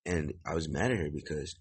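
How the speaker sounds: usual speaking voice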